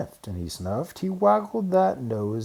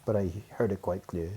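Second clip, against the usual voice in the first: usual speaking voice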